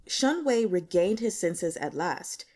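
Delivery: plain reading voice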